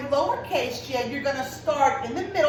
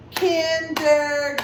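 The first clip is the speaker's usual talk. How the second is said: level, evenly spaced syllables in "kindergarten"; clapped-out syllables; 2 semitones higher than usual. Shown as a demonstration. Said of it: "we clapped for our syllables".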